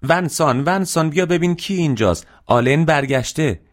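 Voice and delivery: high-pitched motherly shout upstairs; acted joyful yell, pitch soaring; excited calling out. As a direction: call the family over with big news. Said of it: "خانم تواش داد زد".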